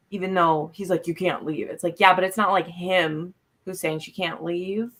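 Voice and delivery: ordinary delivery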